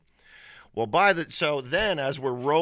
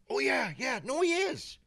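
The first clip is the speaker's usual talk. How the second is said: high-pitched mock insistence; adamant buddy, imitated. Buddy contradicting his friend at the bar, adamant. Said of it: "he starts getting adamant".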